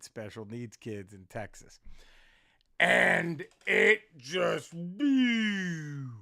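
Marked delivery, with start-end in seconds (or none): 2.8-6.1 long, loud drawn-out blew. Real, not acted. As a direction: stretch it out slow and loud. Joke bombs, drawn-out blew.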